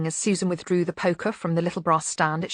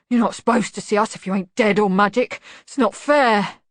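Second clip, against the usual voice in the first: lightly voiced grumbling bogeyman, loud; a hint of sulky protest; mumbling; 3 semitones higher, 5 dB louder than usual. A narrator's reading. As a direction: grumble like a wronged monster. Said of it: "it mumbled".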